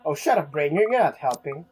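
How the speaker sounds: normal talking voice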